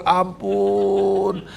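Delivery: long drawn-out, high "ampun"; high, drawn-out comic pleading; mock plea for mercy. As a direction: beg for mercy in mock despair, stretching the word. Mock plea while retelling his failure.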